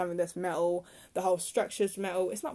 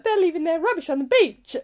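put-on "They're leaving their rubbish on the beach" called out higher and louder, kid pointing it out; loud but not too loud; 9 semitones higher, 6 dB louder than usual. Nephew tells granddad about rubbish, raised voice but not shouting. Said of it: "like, loud, but not too loud".

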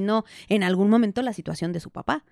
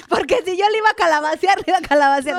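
words broken up by laughter, higher; laughing; 8 semitones higher, 5 dB louder, faster than usual. Real, not acted. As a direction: laugh through the punchline. Laughing while telling the joke. (riendo mientras habla)

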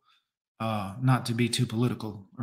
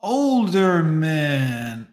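mock dismay; 3 dB louder, much slower than usual. Real, not acted. Mock shock at her answer.

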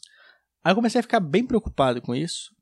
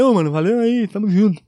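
higher nasal mumbled goodbye; muffled fanho voice; 3 semitones higher than usual. Retold or imitated. Masked man thanks the fan, muffled nasal goodbye. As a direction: imitate a garbled friendly sign-off. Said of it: "falar com a minha voz fanha".

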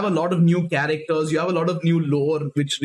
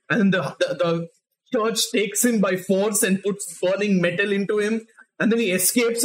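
ordinary delivery

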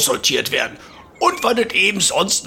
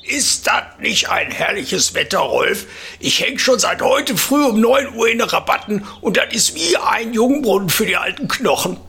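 put-on a cheerful old neighbour calling over the fence; 9 semitones higher than usual. Neighbour Opa voiced in story.

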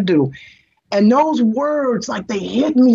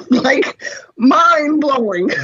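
a tearful laugh breaking into crying, slow on the last words; real tears as he tells her what it meant; 5 semitones higher, much slower than usual. Real, not acted. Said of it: "I couldn't contain my tears".

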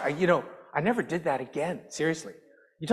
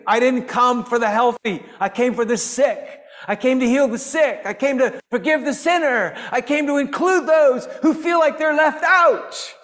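emphatic declaration building to a shout; rousing proclamation; 6 semitones higher, 4 dB louder than usual. Acted. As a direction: preach it out, higher and louder each time. Proclaiming Jesus' mission statement.

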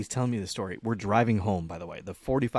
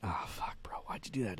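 very soft whispered groan of dismay; hushed dismay; 2 semitones lower, 13 dB quieter than usual. Retold or imitated. To a friend who confessed love, hushed dismay.

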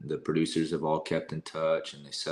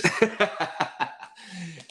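a high, bursting laugh at the memory; high burst of laughter; 13 semitones higher than usual. Real, not acted. Laughing at how the producers set him up, high burst of laughter.

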